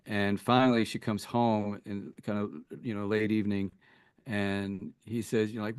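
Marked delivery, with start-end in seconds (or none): none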